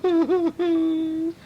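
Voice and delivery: high, loud fake sob; hammed-up wail. A narrator's reading. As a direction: let out a big theatrical cry. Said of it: "Missus Little began to cry".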